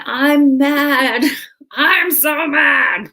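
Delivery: lion grumbling it's mad to the woman who tames it, mock angry; pouting mad lion. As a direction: play a sulky lion insisting it's mad, dragging out the second line. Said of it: "the lion saying".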